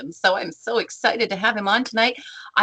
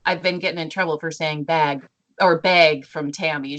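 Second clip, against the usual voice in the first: ordinary talk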